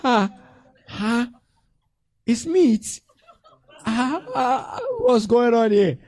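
high, loud comic disbelief; mock shock. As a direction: exclaim in hammed-up astonishment. Acted shock at someone eating meat greedily.

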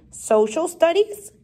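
mildly incredulous teacher, rising at the end; puzzled query. A narrator's reading. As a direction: repeat it as a doubtful question. Teacher puzzled by pupil's suggestion.

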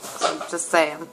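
ordinary delivery

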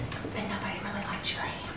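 a cruel secret, breathed; whispered insult. Retold or imitated. Mom whispering to daughter that nobody likes her ham. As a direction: whisper it, unvoiced and soft. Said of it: "she says".

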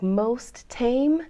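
slow, stretched "most tame"; lingering slowly over it; drawn out. Real, not acted. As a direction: drag the words out. Stretched listing word.

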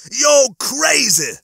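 high, loud, slow-stretched cry; outraged yell. A narrator's reading. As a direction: shout it at your attacker, stretching it out. Injured cat yells at Lister.

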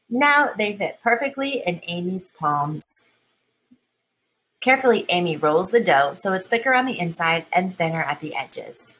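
plain reading voice

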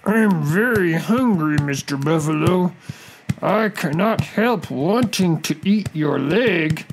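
high, slow crocodile character voice; drawn-out, high-pitched confession of hunger; hungry crocodile. Acted. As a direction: stretch the words like a sly storybook crocodile.

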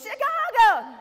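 cheerful seatmate proudly answering Chicago; beaming reply. Retold or imitated. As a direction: announce it like great news. He answers brightly.

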